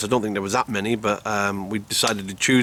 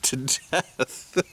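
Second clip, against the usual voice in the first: breaking into laughter; 8 semitones higher, slower than usual. Real, not acted. Camper admitting night noises frighten you, laughing. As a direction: laugh breaks up the words, then higher.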